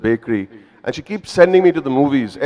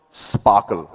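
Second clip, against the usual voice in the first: mother's blessing; 6 semitones lower than usual. Retold or imitated. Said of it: "She says one wonderful word".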